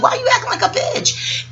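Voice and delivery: high-pitched mock disbelief; squeaky-high retold challenge; incredulous challenge. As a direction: imitate baffled friends, voice way up. Voices his homies' incredulous challenge.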